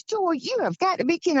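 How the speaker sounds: high-pitched disbelieving retort; high, mock-incredulous scolding; retold incredulity.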